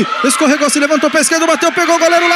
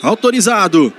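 normal talking voice

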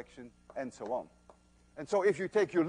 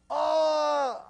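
sudden realisation; 10 semitones higher, 4 dB louder than usual. Real, not acted. Sudden realisation about the sunglasses.

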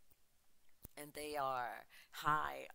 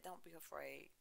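softer, gentle reassurance; comforting; 8 dB quieter than usual. Acted. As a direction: soothe frightened disciples softly. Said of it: "the voice that was comforting them".

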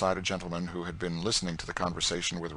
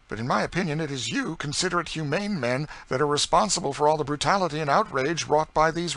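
put-on higher, indignant young man; earnest young gentleman; 9 semitones higher, 8 dB louder than usual. Young gentleman accusing the planter of complicity, indignant. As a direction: press the moral point hard.

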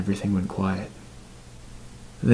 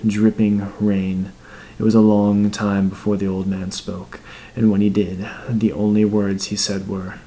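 ordinary delivery

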